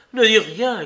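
a loud, urgent plea for silence; urgent hush. A narrator's reading. Heathcliff hushing Catherine.

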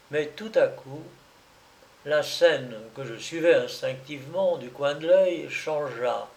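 plain reading voice

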